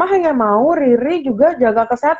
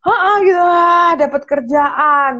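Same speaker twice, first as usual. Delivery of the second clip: mock cocky glee; 6 semitones higher, slower than usual. Mimicking her overconfident younger self bragging about a new job. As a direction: show off about landing the job.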